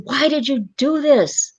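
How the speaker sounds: high-pitched anguished cry, last words slowed right down; retold yell at God. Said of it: "yelling at God".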